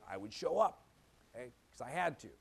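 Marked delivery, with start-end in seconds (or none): none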